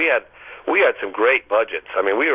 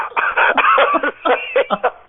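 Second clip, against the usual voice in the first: a burst of high, airless laughter; high-pitched laugh. Real, not acted. Guest recalling his on-air panic, high-pitched laugh. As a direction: laugh high and breathless at yourself.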